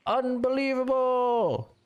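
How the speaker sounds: drawn-out, high cry of disbelief; stretched-out caster's exclamation; excited disbelief. Real, not acted. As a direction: exclaim it high and slow, amazed. Caster reacts to sudden league ending.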